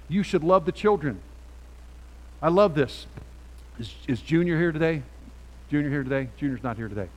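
usual speaking voice

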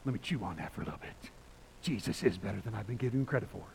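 low put-on musing voice; play-acted low, self-addressed pondering; savoring new believers' excitement. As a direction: drop low and chew it over. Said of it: "I'm just like".

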